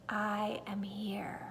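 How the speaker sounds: slow hushed whisper of a spirit. A narrator's reading. Old lady spirit revealing herself in a slow whisper. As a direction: breathe it out softly and slowly.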